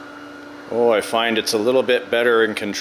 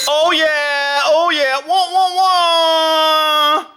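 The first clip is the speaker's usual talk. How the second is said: high, loud triumphant whoops; loud excited cheer; 5 dB louder, much slower than usual. Real, not acted. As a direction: cheer at full volume. Celebrating successful landing.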